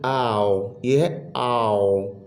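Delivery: slow आओ gliding from आ to ओ; diphthong demo. Shown as a demonstration. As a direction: stretch आओ and glide across. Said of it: "ये इससे इधर glide करना है". (आओ को धीरे से आ से ओ तक ग्लाइड करना)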